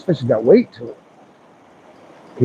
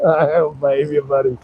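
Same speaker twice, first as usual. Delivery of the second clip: ordinary delivery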